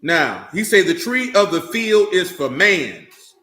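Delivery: preacher hammering the verse; emphatic quote. Acted. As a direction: drive the verse home word by word. Preacher stresses scripture.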